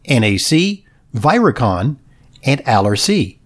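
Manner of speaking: upbeat sponsor announcement voice; ad read. Acted. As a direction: read it like a polished radio ad.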